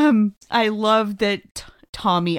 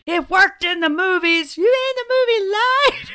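high, loud mock-outraged quote; over-the-top incredulous movie voice; movie character impression; 11 semitones higher, 4 dB louder than usual. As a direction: squeal it like a hammy B-movie actor. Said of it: "they almost all talk like that".

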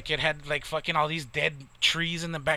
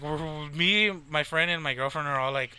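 ordinary delivery

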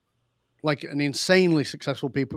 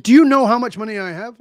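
louder, higher indignant challenge; 6 semitones higher, 10 dB louder than usual. Retold or imitated. Billionaire client insulted he can't afford the coach, indignant and loud. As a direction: bristle at the insult and push back.

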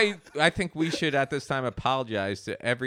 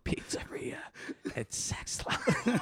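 slow, sultry hotline whisper; creepy whisper; 10 dB quieter, slower than usual. Acted. Creep finishing the business name, slow whisper. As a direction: whisper it like a sleazy phone line.

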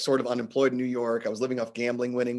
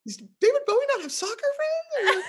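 mock incredulous. Incredulous about [name].

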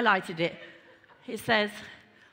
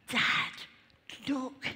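hushed, whispered aside to a father; Jesus as son; 3 semitones higher, 3 dB quieter than usual. Retold or imitated. Preacher voices Jesus calling his Father, hushed and whispery. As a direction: imitate a son quietly getting dad's attention. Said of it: "Jesus really is like".

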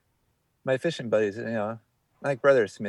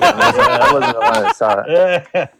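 loud, slow words through laughter; laughing hard while begging off; laughing protest; 4 semitones higher, 9 dB louder, slower than usual. Real, not acted. Guest dodging the host's story request, laughing loudly.

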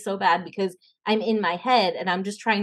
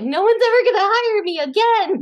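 mock despair; 12 semitones higher, 7 dB louder than usual. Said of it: "I probably cried".

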